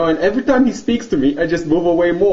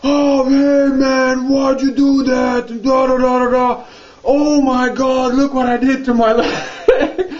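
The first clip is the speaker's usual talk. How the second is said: high, drawn-out imitation of complaining; mock blabbering whine; 6 semitones higher, slower than usual. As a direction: wail it slowly like a sore loser, breaking into laughter. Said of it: "he starts with the blabbering and bitching".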